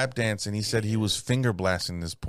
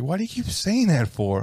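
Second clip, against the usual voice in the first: higher mock puzzled woman; puzzled women; 7 semitones higher than usual. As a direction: ask it bemused, pitched up. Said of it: "they're like".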